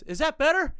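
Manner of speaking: very high, loud question; imitated high-pitched shouted question; calling up. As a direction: holler it up to the balcony. Friend shouts up to neighbour.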